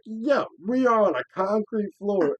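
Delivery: high, loud, slow protest; exaggerated, high-pitched objection; retold alarmed protest. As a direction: stretch out each word in loud disbelief. Retold protest after being flipped.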